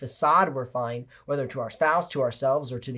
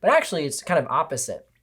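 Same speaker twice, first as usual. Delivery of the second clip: normal talking voice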